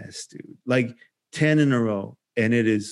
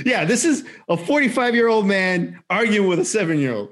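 amused disbelief; 7 semitones higher than usual. Real, not acted. Laughing at his own story.

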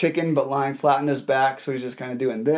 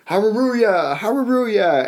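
joyful praise; 7 semitones higher than usual. Retold or imitated. Freed man praising God on his back, high joyful calls. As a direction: sing out praise with joy. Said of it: "He began saying".

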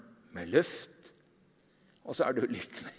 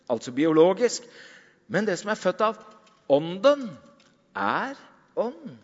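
louder, higher preaching voice; preaching at full force; 6 semitones higher, 5 dB louder than usual. Acted. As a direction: proclaim it to the congregation. Preaching Jesus' words. (forkynnende med full kraft)

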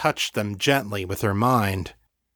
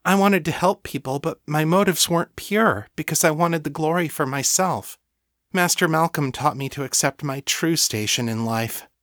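high-pitched, earnest devotee confessing; humble thrall explaining herself; devoted servant; 6 semitones higher than usual. Acted. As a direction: confess earnestly in a higher character voice. Voices Lena in story.

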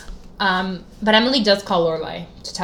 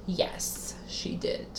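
barely whispered confirmation; whispered yes; 16 dB quieter, much slower than usual. Real, not acted. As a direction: breathe it out as a hushed secret. Hushed confirming aside.

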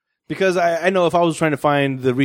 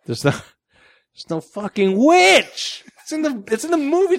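exasperated; 10 semitones higher, slower than usual. Real, not acted. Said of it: "exasperated complaint".

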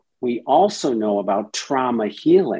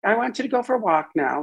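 higher, fast, dismissive; brushing off; 9 semitones higher, faster than usual. Retold or imitated. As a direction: hurry the part off.

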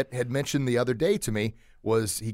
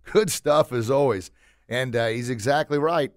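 ordinary talk, nothing marked

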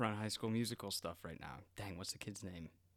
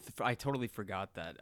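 ordinary talk

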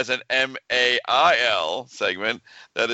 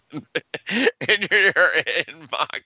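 real giggles breaking up the words, higher pitch; laughing while reading; 6 semitones higher, slower than usual. Real, not acted. Co-host cracking up at innuendo in listener's email, laughing. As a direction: try to read on through helpless laughter.

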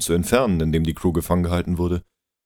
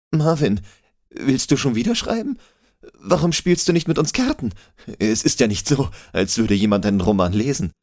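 put-on cheerful high young man; 8 semitones higher, 4 dB louder than usual. David cheerfully calls Marvin over.

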